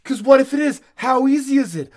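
loud, high excited yelling; excited shout. Real, not acted. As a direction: blurt it out, worked up. Excited outburst over conspiracy.

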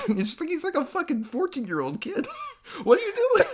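high, laughing disbelief. Real, not acted. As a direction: laugh through the words. Laughing at the character.

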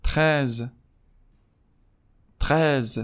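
ترز with a French r; ترز as French treize. Shown as a demonstration. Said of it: "سیزده".

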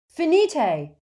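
loud, high shout; cried spell. A narrator's reading. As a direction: cry out a spell urgently.

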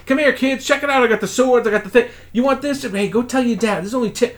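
put-on high, excited carny bark aimed at kids; street hawker pitch. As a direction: sell junk toys like a pushy glow-stick vendor. Street vendor hawking to kids.

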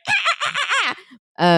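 high monkey chatter; monkey noises. Retold or imitated. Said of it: "that monkey's going".

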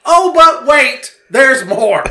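loud, high, drawn-out announcement; hammed-up sales hype, slow and high; mock infomercial pitch. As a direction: tease the next absurdity like a TV pitch. Mock pitch announcing further absurdity.

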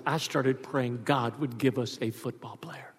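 hushed confiding whisper; whispered secret. Retold or imitated. Father confiding to his little son, whispered. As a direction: let him in on a secret.

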